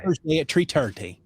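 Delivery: put-on Irish with "three thirty" as "tree tirty"; hard T for every TH, playing at Irish; mock Irish accent. Host plays up the meeting time, mock Irish accent. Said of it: "alienated all of our Irish listeners".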